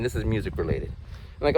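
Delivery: ordinary talk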